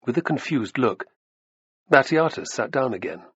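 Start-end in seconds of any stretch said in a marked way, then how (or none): none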